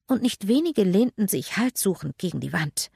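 ordinary delivery, nothing marked

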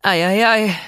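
high, loud "oh dear"; dismayed groan. Acted. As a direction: wince at the trouble. Spidey overwhelmed.

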